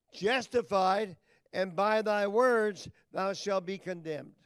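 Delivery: loud, slow, booming scripture reading; preaching scripture. Acted. Preacher thundering the verse at the congregation, slow and loud.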